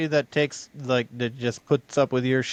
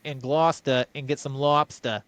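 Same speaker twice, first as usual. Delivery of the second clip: hammed-up Massachusetts fisherman, R's dropped; Massachusetts accent; 3 semitones higher than usual. Local planning a boat trip, Massachusetts accent. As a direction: put on a broad Massachusetts accent. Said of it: "that's the accent".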